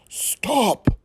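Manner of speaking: sharp high yell; sudden alarmed shout; parents yelling stop. Acted. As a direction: shout it to stop a climbing child. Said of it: "Her parents yelled".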